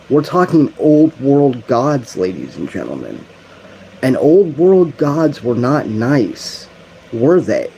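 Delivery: fervent loud sermon voice; preaching. Real, not acted. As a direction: preach it with building force. Preaching with rising force.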